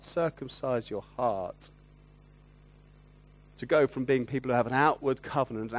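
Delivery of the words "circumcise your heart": "Circumcise your heart" said slowly and deliberately, each word weighed; slow emphatic repeat. Shown as a demonstration. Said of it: "to circumcise your heart".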